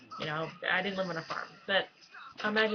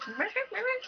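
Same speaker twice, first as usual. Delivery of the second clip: squeaky horn imitation; 14 semitones higher than usual.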